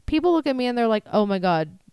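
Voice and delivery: ordinary talk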